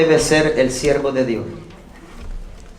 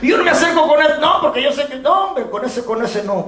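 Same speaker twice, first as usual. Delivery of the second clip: imitating a smug believer; 11 semitones higher than usual. Preacher mimics a believer snubbing a gossip, high and mocking. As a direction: play the churchgoer who dodges the gossip.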